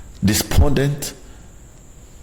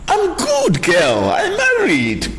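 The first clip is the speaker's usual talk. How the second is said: insistent; much slower than usual. Acted. Acted wife insisting to in-law.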